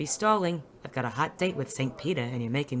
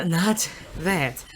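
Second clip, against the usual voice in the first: stammering, slow and louder; flustered stammer; 5 semitones higher, 8 dB louder, slower than usual. Acted. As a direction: trip over the first word, embarrassed. Flustered denial after kiss.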